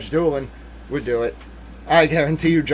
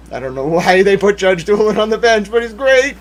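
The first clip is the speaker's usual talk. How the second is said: high, loud burst of praise; real enthusiasm spilling over with a laugh; excited gushing; 7 semitones higher, 8 dB louder than usual. Real, not acted. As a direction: gush louder and higher, a laugh breaking in.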